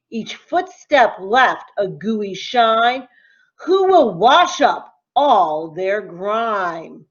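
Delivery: ordinary delivery, nothing marked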